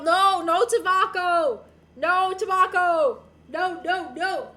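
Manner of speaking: loud, slow, insistent no; emphatic refusal. Real, not acted. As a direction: shut the idea down loudly.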